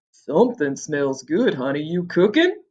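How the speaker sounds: a hint of a hungry giant; deep growl. Male Cyclops sniffs at his wife, lightly growled. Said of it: "His voice was a deep growl".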